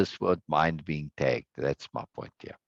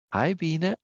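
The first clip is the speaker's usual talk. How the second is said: higher, badly faked female voice; Norma; 8 semitones higher than usual. Streamer says hi on Norma's behalf, attempted impression. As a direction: have a go at imitating a woman, pitched up. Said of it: "I can't do your voice".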